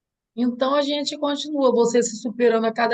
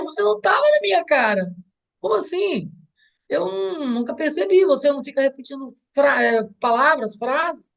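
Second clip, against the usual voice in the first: higher-pitched, baffled retold protest; incredulous protest; 3 semitones higher than usual. Mother re-enacting her reply to her daughter, incredulous. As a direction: protest in disbelief, pitch raised.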